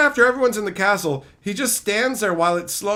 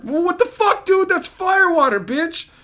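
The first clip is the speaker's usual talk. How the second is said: mock hero outburst; 11 semitones higher, 5 dB louder than usual. Mocking the hero's reaction.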